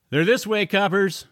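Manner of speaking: loud, high call to the police. Acted. Smoker calling police to chase George, loud. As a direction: shout directions down the alley. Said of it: "said one of the smokers".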